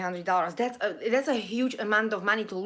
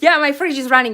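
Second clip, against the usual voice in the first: loud, higher, amused reply; laughing; 5 semitones higher, 10 dB louder, faster than usual. Real, not acted. Admitting to viewers the fridge joke landed, laughing. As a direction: laugh as you own up to the joke.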